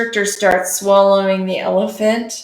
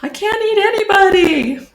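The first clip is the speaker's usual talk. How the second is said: toothachy dinosaur; 12 semitones higher, 3 dB louder than usual. Acted. Dinosaur begging the traveling dentist for help, high and whiny. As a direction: plead in a high-pitched creature voice.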